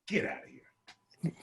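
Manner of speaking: slow whispered release; soft, drawn-out whisper; hushed dismissal. Acted. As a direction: whisper it, letting him off quietly. Cop quietly lets him go.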